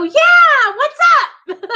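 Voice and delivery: high, loud, bright hello with a laugh; cheery greeting. Retold or imitated. Answering a fan's call with a loud, laughing hello. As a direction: greet them like an old friend, laughing. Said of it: "And I'll go".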